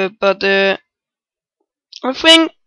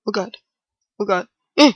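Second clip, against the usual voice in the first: ordinary talk, nothing marked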